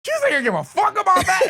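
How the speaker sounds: high, loud defiant mock yell; retold angry yell. Yelling at Two-Face's girl about cussing, high loud defiant yell. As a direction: throw it back at her.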